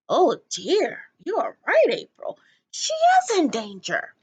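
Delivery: hammed-up alarmed old woman; dramatic old lady. A narrator's reading. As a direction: gasp it out theatrically. Miss Forcible agrees dramatically.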